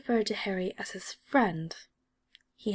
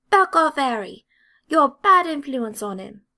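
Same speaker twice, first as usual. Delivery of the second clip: loud, higher furious order; angry warning; 5 semitones higher, 8 dB louder than usual. A narrator's reading. Angry redhead warns Draco off his friend, loud and furious. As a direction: bark it as a threat. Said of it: "The angry Ginger gets even angrier".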